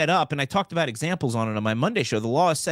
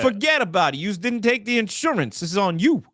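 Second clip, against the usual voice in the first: put-on a touch of New York accent, a bit higher; tough-guy New York "forget about it"; light New York wiseguy accent; 6 semitones higher than usual. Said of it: "I'm doing it in my New York voice".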